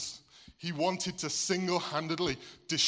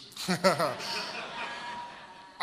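breathy laugh; 2 semitones higher than usual. Real, not acted.

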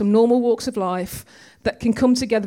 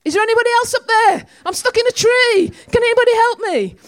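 high, loud, fast calls for anybody else; panicked shout for help from the tree; 11 semitones higher, 6 dB louder than usual. Retold or imitated. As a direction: yell upward, desperate for another helper. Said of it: "the man says".

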